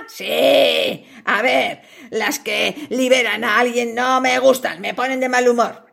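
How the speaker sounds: low, menacing spider voice; a big hungry spider toying with its prey. Acted. Spider answers fly.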